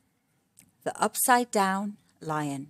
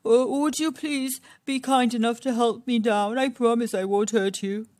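ordinary delivery

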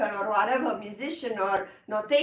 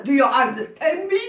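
louder, with a nervous laughing edge; anxious plea; 6 dB louder, faster than usual. Shown as a demonstration. Teacher showing rushed delivery as mock anxiety. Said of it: "That's anxiety".

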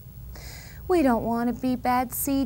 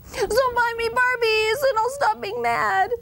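put-on high whiny child's voice; child bargaining for Barbies; bratty kid; 11 semitones higher than usual. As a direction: whine it like a spoiled kid. Kid voiced in store tantrum.

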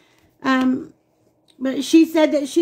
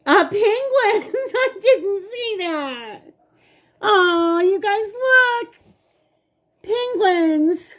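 high, drawn-out squeal with laughter; delighted surprise; 7 semitones higher, slower than usual. Real, not acted. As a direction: gush tearfully high, stretching the words. Delighted surprise on seeing the penguins.

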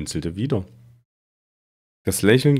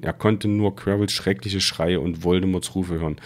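usual speaking voice

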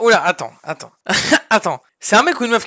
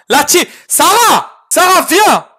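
calling out; 8 semitones higher, 6 dB louder, slower than usual. Real, not acted. Calls out to Sarah.

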